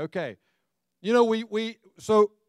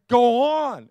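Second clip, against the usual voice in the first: a pushy, raised go on at a slow car; impatient urging; 6 semitones higher, 9 dB louder than usual. Retold or imitated. Preacher to congregation, mimicking impatient urging at traffic. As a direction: urge the car ahead on, half yelling. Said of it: "And you're saying".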